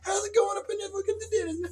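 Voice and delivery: high voice parroting learned phrases; silly high-pitched cat waitress; cat woman. Acted. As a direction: chirp stock lines like a cat copying speech. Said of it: "she learned these words".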